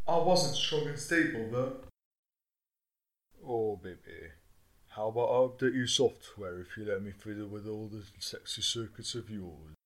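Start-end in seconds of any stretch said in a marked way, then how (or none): 3.4-9.7 seductive voice. Acted. Seductive offer.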